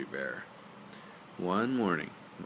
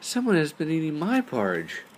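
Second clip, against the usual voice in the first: higher, louder mama bear; a fussing mama bear, pitched up; Mama Bear's medium voice; 9 semitones higher, 5 dB louder than usual. Acted. Mama Bear finds her porridge eaten, raised voice. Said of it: "in her medium-sized voice".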